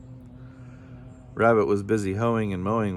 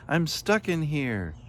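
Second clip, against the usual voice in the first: high, slow plea; calling out; 7 semitones higher, slower than usual. A narrator's reading. Trapped voice calls from inside, high slow plea. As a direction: call it out high and drawn out. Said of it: "the voice called".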